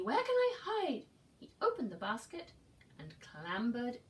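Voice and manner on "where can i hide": panicked. Acted. Eddie panicking.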